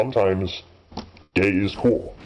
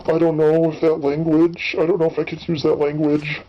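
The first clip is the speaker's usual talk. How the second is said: higher, slow, hesitant mock voice; mock timid objector; 8 semitones higher than usual. Mocking people today who balk at words, hammed-up higher hesitant voice. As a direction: ham up a nervous, fretting prude. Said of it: "these days people will be like".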